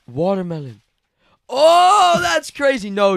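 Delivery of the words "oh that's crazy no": high, loud excited yelp; excited shout. Real, not acted. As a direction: cry out in delighted surprise. Excited reaction to watermelon surprise.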